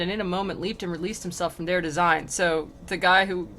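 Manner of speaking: ordinary talk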